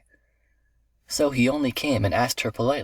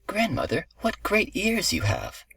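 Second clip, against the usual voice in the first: high, slow wonder; astonished little girl; 10 semitones higher than usual. A narrator's reading. Girl astonished at grandmother's looks, high wondering voice. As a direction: marvel in a high child's voice.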